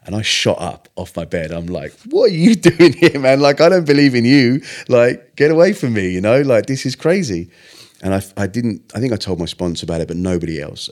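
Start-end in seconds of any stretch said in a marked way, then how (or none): 2.1-7.5 loud, high-pitched outburst of disbelief; acted-out panicky yell, voice shooting up; retold alarmed shout. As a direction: shout them away in startled protest. Retelling his alarmed outburst at Jesus.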